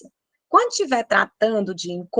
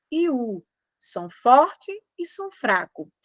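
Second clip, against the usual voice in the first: usual speaking voice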